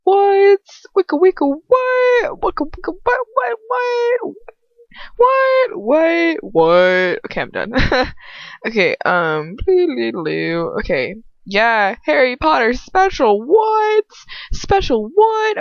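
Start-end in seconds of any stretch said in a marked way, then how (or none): 0.0-7.2 high-pitched goofy chant; mock-hype squawking; silly hyped squealing. 12.1-15.6 high squealed hype; goofy, over-excited squeal; hyped-up mock intro. Same hyped mock intro resumed.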